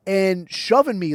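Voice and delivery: plain reading voice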